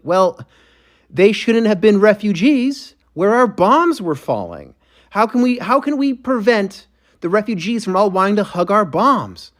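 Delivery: mock spokesperson. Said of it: "And then they're like".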